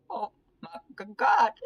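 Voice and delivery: choked wail breaking into a loud cry; bawling cry. Acted. Heather bawling to her friends, a choked crying wail. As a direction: sob it out, then burst on God. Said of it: "She continues bawling".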